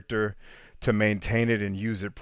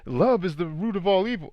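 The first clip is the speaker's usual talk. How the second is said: higher, mocking misquote voice; 9 semitones higher than usual. Mocking people who misquote scripture, higher and sneering. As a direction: parrot the wrong version mockingly. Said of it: "bastardized versions of scripture".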